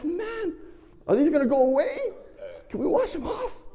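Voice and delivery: high-pitched, pleading comic worry; worried whine. Retold or imitated. Worried the marks are permanent.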